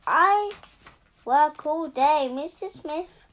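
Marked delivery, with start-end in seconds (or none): 0.1-2.3 loud, high, indignant outburst; said loudly. Acted. Wife berating her husband, loud high outburst. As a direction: fling it at him at full volume. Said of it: "said loudly".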